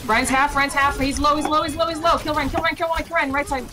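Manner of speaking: urgent high callouts; combat callout. Real, not acted. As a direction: shout the target calls fast. Calling targets in a fight.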